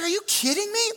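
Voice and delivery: very high-pitched mock disbelief; incredulous. New leader reacts to a staffer's mob boss accusation, incredulous. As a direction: squeak it out in acted shock.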